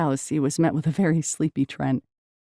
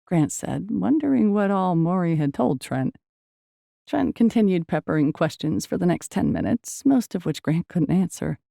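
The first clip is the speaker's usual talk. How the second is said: ordinary delivery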